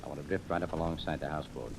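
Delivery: hushed. Acted. Detective briefing his men as they creep up, hushed. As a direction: murmur it so the houseboat can't hear. Sneaking up on the houseboat.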